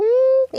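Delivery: high squeaky hum like air escaping a balloon; balloon squeak. Retold or imitated. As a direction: squeeze out a sharp high mm like a deflating balloon. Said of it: "saca unos soniditos de mm".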